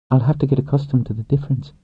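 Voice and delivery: lightly voiced young woman; woman's voice. A narrator's reading.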